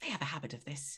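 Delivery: conspiratorial whisper. Acted. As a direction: let it out on breath. GM confides aside.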